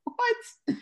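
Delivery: high, loud incredulous cry; disbelief. Retold or imitated. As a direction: react like the caller said something absurd. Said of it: "I'm like".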